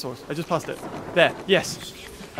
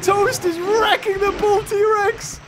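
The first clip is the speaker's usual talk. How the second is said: laughing excitement; 11 semitones higher than usual. Real, not acted. Dinosaurs brawling in game.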